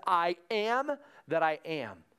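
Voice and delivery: slow, weighty "am"; solemn declaration. Real, not acted. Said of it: "God's answer to Moses".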